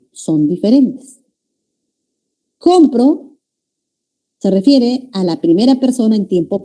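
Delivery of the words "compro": "Compro" with a high, stressed COM; COM-pro as a present-tense example; stress on first syllable. Shown as a demonstration. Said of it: "la ubicación del acento".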